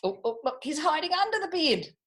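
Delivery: ordinary delivery, nothing marked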